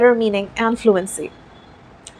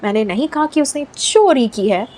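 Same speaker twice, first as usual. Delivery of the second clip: "चोरी" stretched and raised in pitch; stress on चोरी. Shown as a demonstration. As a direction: hit the word "चोरी". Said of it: "अब stress करते हैं stole में".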